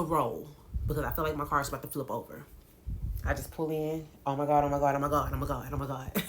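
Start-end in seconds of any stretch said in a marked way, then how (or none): 4.3-6.2 frantic repeated oh my God, dropping away; panicked oh my God. Retold or imitated. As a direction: relive the terror of the skid. Panicking in car while hydroplaning.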